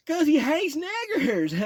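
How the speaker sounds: put-on shouted high, slow Texas accent; loud Texas accent cackling punchline. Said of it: "heavy Texas accent and a big old grin".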